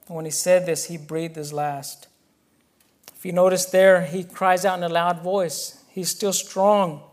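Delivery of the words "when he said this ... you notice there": usual speaking voice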